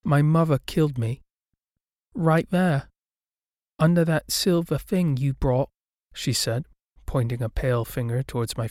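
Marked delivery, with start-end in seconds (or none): 0.1-5.7 lightly voiced child, higher pitch; little ghost girl. A narrator's reading. Ghost girl reveals her death.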